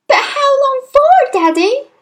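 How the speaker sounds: put-on very high, loud child's question; distressed little girl, rising at the end; anxious cry. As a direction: cry out pleadingly to a father. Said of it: "Matilda cried".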